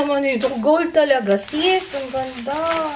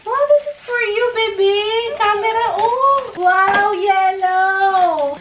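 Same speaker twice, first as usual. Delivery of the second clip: excited showing off; 6 semitones higher, slower than usual. Real, not acted. Showing the camera to baby. (masiglang pagpapakita sa bata ng kamera)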